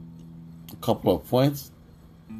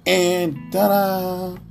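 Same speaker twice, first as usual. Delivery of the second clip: big drawn-out ta-da reveal; excited; 10 semitones higher, 4 dB louder, much slower than usual. Real, not acted. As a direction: announce it like a prize. Reveal of top coin.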